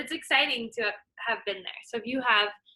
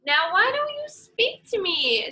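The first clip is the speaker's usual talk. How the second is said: higher, louder wounded python; hurt python; 10 semitones higher, 5 dB louder than usual. Acted. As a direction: python puzzled by the snub. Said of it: "said the python to himself".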